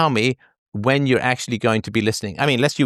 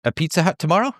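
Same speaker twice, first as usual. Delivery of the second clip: uptalk; 6 semitones higher than usual. Retold or imitated. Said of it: "and then I say".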